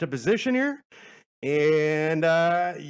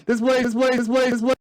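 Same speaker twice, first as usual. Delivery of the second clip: calling out; 8 semitones higher, 8 dB louder, faster than usual. Real, not acted. Calling others over, loud.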